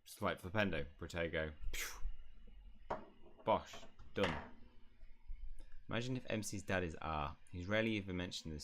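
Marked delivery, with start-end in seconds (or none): 1.7-3.0 voiceless "pew" whoosh; spell-firing noise. Shown as a demonstration. Player casting Flipendo and Protego, imitated "pew". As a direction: imitate a quick zap on the breath.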